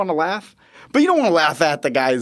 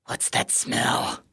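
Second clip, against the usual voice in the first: whisper; 8 dB quieter, much slower than usual. Retold or imitated. Asking patient quietly.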